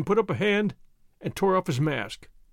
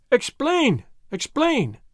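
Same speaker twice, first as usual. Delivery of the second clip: crying out; 7 semitones higher, much slower than usual. A narrator's reading. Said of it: "they cried".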